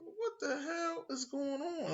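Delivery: high, soft and slow, almost breathless; stunned, hushed disbelief. Retold or imitated. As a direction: let it hang in quiet shock. Hears the attacks confirmed.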